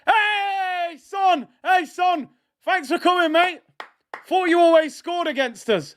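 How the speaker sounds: high, jeering send-off shouts; mocking farewell. Real, not acted. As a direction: wave the rival off with glee. Taunting substituted rival players.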